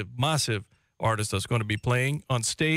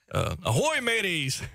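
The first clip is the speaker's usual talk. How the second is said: pirate voice; 6 semitones higher than usual. Pretend. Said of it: "read it in a kind of pirate voice".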